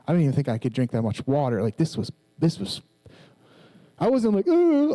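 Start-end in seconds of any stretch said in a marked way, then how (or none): 4.5-4.9 a high, loud mock sob "ooh"; hammed-up wailing cry; mock ugly-cry wail. Imitating the ugly cry he did not do before the congregation. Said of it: "ugly crying".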